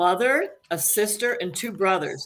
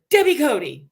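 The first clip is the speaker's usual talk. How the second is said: higher, louder call down a hallway; calling out a name; 6 semitones higher, 6 dB louder than usual. Retold or imitated. Friend calls her name from behind in a hallway, higher and louder. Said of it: "and I hear".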